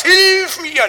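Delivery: high, loud cry for help; desperate shout. Retold or imitated. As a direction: scream for help with everything. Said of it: "heißt das". (verzweifelter Hilfeschrei)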